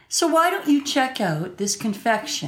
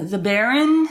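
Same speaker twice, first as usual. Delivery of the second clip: normal talking voice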